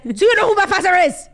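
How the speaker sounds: high, loud entitled heir voice; shrill-free mock tantrum at the door; imitating a drunk rich heir. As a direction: play the spoiled son demanding entry. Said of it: "He sounded like Anna Delvey".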